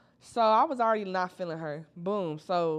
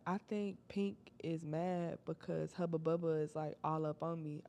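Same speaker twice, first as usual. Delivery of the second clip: whispered; 9 dB quieter than usual. Retold or imitated. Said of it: "She whispering".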